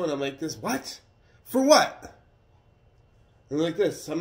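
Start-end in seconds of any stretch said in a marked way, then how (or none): 0.6-2.2 high, loud retold "what?!"; loud incredulous reaction, retold; shocked disbelief. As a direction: blurt it out in high-pitched disbelief. Retold reaction to arrest warrant.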